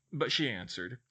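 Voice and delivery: ordinary delivery, nothing marked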